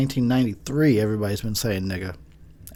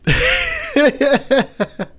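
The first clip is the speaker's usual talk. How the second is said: high laugh; 5 dB louder than usual. Real, not acted.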